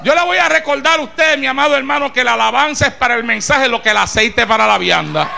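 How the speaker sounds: preacher exhorting the brethren, full force; fiery preaching. Acted. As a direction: preach it out to the hall.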